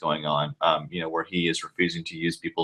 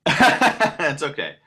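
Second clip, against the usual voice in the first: laughing; 7 semitones higher, 4 dB louder, much slower than usual. Real, not acted. Laughs while answering.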